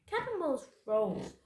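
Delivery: plain reading voice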